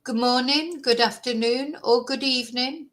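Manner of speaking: ordinary talk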